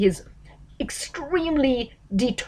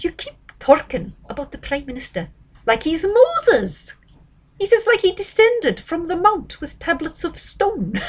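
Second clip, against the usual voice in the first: put-on higher-pitched, rough stab at a Scottish accent; butchered Scottish accent; 9 semitones higher than usual. Astrologer quoting the politician's put-down to a journalist, butchered Scottish accent. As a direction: attempt George Galloway's Scottish accent, pitched up. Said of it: "I'd love to do the Scottish accent, but I'm gonna butcher it".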